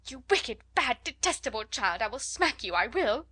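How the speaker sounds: emphatic, flustered scolding; excited angry threat. A narrator's reading. Flustered teacher to a screaming child, angry outburst. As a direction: lash out helplessly, hammering the adjectives. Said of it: "quite so helplessly and excitedly".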